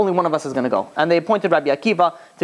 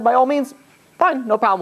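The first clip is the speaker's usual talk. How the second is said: eager agreement; 9 semitones higher than usual. Retold or imitated. Sages agreeing to leave.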